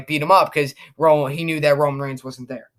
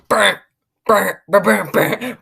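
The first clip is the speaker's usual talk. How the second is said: punchy "brr" bursts, raised pitch; imitated entrance theme; 3 semitones higher than usual. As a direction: mimic a wrestler's entrance riff. Imitates Brock Lesnar's entrance music.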